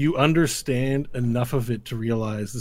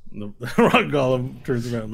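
ordinary talk

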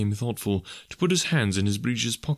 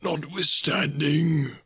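higher, drawn-out ghostly voice; ghost of Marley; 7 semitones higher, much slower than usual. A narrator's reading. The ghost answering Scrooge, hollow spectral intoning.

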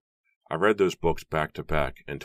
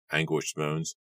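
usual speaking voice

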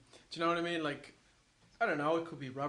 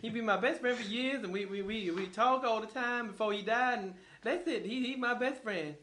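higher-pitched, earnest celebrity impression; Eddie Murphy; 5 semitones higher than usual. Star defending an old friendship, high animated impression. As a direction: insist on it as the star comic. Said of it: "Can Eddie Murphy do an impression".

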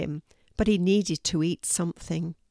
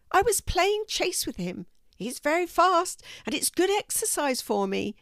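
chatty, high-pitched cat; high, good-natured cartoon cat; friendly cat character; 7 semitones higher than usual. A narrator's reading. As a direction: explain eagerly in a raised cat voice. Narrator voices the cat Seymour.

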